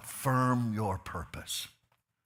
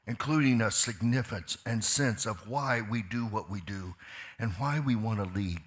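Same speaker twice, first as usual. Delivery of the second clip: ordinary talk, nothing marked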